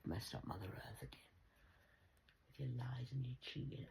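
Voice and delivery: hushed whisper. Real, not acted. Muttering about Mother Earth, whispered. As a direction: breathe it out unvoiced.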